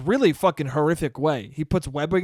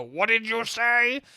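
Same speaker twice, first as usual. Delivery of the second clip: high, slow mocking aunt voice; aunts shocked; 5 semitones higher, slower than usual. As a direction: drag out the offended question. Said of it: "They go".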